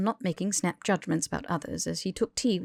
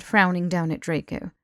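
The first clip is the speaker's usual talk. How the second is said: usual speaking voice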